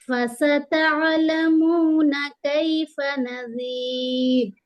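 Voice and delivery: slow melodic tajweed chant; Quran recitation. Acted. Teacher reciting the verse for students, melodic chant. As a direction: recite slowly, holding every madd.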